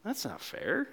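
put-on sulking older brother. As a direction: grumble it under your breath like a sulky sibling. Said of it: "Maybe you relate with the older brother".

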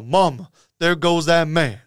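slow, lightly put-on TV commentator; Mark Jackson. Imitating a commentator's call of the game, slow announcer impression. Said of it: "you know, Mark J".

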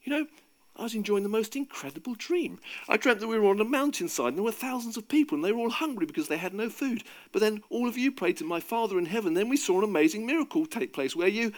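high, eager dream report; excited retelling. A narrator's reading. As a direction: gush about an incredible dream. Jesus excitedly retells his dream.

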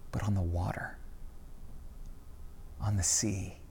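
soft whisper. A narrator's reading. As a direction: drop to a whisper on the image. Narration, hushed image.

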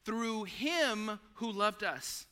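"Through him who loved us" said very slowly and deliberately; slow emphatic finish. Shown as a demonstration. Pastor finishing the verse, slow and emphatic. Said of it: "Let it out, finish it".

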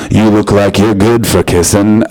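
low, loud, slow creep voice; hammed-up creepy come-on, deep and drawn out; creepy man. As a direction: say it slowly as a menacing creep. Acting creepy man after heavy breathing.

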